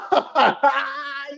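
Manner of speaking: high burst of laughter; laughing hard at the joke; real laugh. Real, not acted. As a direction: crack up high. Laughing at the greeting.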